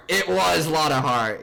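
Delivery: loud, slow, drawn-out confirmation; emphatic recall. Real, not acted. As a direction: repeat it slowly and loudly, pitch settling. Repeats the remembered name, settling.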